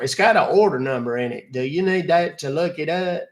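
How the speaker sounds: a lightly voiced, fussy old man calling about a charge; old caller Buster. Caller on scam line, a lightly voiced fussy old man. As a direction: play the confused older customer.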